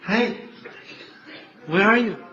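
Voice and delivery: lightly acted searching cry; high-pitched imitation of a devotee calling. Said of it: "And he cries".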